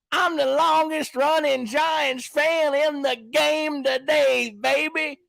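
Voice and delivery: slow, high, mocking brag; drawn-out hammed-up boast; imitating Kyle Pizzle. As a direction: stretch it out like a swaggering showman. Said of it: "He says it like this".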